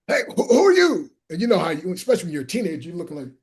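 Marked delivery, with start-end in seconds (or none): 0.1-1.1 much higher, louder eager greeting; excited call. Retold or imitated. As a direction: greet the newcomer with big enthusiasm. Guy calling out to teen.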